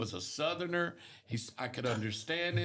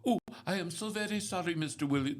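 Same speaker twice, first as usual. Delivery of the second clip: put-on higher-pitched, over-polite Indian accent; imitated Indian accent; 6 semitones higher than usual. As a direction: mimic an apologetic Indian call-center agent's accent. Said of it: "they got that strong accent".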